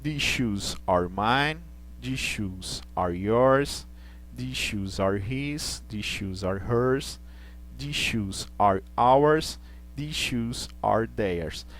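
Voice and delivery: slow, rising on each pronoun; slow pronunciation drill. Shown as a demonstration. Teacher drilling possessive pronouns for the class, slow. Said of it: "Então, olha os exemplos".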